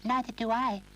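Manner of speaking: high, sniffy doll agreeing. Acted. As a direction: sniff it out with disdain. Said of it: "sniffed the doll".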